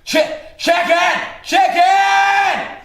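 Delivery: high, loud drawn-out call. Acted. Dying man calling for the boy from downstairs, high loud call. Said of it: "I heard him calling my name out".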